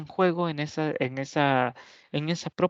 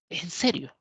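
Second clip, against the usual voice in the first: higher, amazed "¿En serio?"; exclamative question; 4 semitones higher than usual. Shown as a demonstration. Teacher demonstrates the exclamative question with higher, amazed surprise. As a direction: let "¿En serio?" jump up in astonishment. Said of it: "una pregunta exclamativa".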